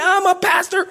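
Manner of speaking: high, loud indignant declaration; indignant shout. Retold or imitated. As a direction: throw your title at her in outrage. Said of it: "I was pretty upset".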